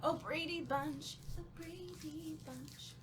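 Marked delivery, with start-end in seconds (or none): none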